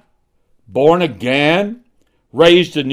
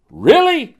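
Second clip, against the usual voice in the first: incredulous really; 11 semitones higher than usual. Acted. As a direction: scoff at the excuse with a sharp high jab. Preacher mocks sinner's excuse.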